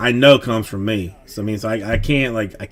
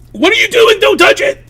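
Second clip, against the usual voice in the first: piercingly high, loud screech; frantic scolding; 6 dB louder than usual. Retold or imitated. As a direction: yell like an acted panicking parent. Retold panicked yell at child.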